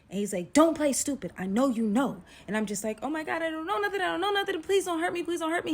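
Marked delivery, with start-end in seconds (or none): 0.5-2.2 raised, louder accusing voice; imitated angry demand. Date confronting her through the bathroom door, angry. 3.0-5.7 high, frantic begging; panicked pleading. Retold or imitated. Frightened woman begging her date through the door, panicked pleading.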